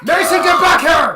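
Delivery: high, very loud mock mom scream; yelling mother. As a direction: screech after a runaway kid. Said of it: "their morbidly obese mother follows them".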